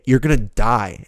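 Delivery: ordinary talk, nothing marked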